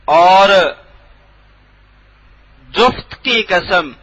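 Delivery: ordinary talk, nothing marked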